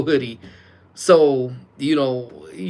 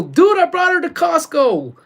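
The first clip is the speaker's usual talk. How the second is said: much higher, louder boast; excited retold reply; 6 dB louder than usual. Streamer retelling a phone answer to Amy, excited. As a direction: re-enact your gleeful announcement at full pitch.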